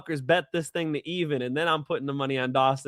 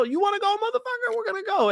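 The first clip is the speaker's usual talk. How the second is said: squeaky falsetto mock challenge, much higher; mock fighter taunt; faster than usual. Retelling a fighter's taunt to his opponent, squeaky high mock challenge. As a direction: play the cocky brawler in a high squeaky voice.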